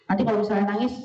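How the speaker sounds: ordinary talk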